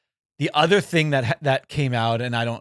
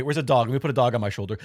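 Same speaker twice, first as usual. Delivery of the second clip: ordinary talk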